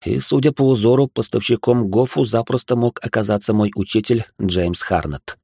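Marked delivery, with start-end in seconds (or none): none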